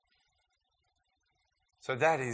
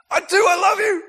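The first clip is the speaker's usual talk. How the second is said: hammed-up insisting friend; 13 dB louder than usual. Mock Aaron insisting his love.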